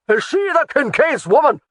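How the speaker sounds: a high, loud, bellowing demand; bellowed. A narrator's reading. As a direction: bellow the question at her. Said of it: "the man in the middle bellowed".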